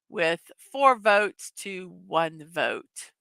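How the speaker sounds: slow, deliberate result read; slow announcement. Real, not acted. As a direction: spell out the score slowly.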